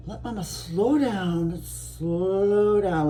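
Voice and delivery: stretched-out, unhurried words; slowing herself down on purpose; deliberately slow. Shown as a demonstration. To viewers, showing how to slow down, drawn out slowly. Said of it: "going really fast here".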